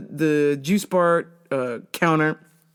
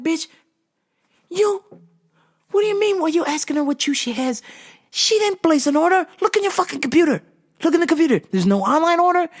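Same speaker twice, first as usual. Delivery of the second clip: higher-pitched exasperated scolding; exasperated rant; 10 semitones higher than usual. Retold or imitated. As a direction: tear into the clueless coworker. Scolding coworker in head.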